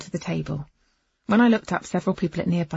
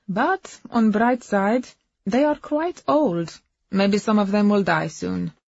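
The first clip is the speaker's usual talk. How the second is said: put-on higher, perky foreign-accented woman; Agnes cheering up; 4 semitones higher, 7 dB louder, slower than usual. Shunned wife finding a bright side, perky accented voice.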